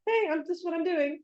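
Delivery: high, bright, chirpy hello; cheery random message. Retold or imitated. Friend randomly calling, high cheery greeting. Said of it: "just be like".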